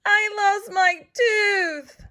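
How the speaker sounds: high, slow wailing cry. Acted. Gilbert crying to his mother over the lost tooth. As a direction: wail it through tears. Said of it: "ran to his mother and cried".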